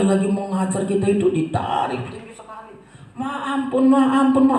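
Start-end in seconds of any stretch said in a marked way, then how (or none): 3.1-4.6 imitated high, louder child's plea; child begging mercy. Child to mama pulling their ears, imitated pleading. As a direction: plead urgently, acting out a child being punished.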